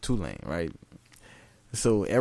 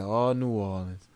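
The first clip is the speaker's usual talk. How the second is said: Oh drawn out, soft and lower, slow and wistful; slow dreamy imitation; 4 semitones lower, 5 dB quieter than usual. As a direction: imitate the doctor's faraway sigh. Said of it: "That's how he said it".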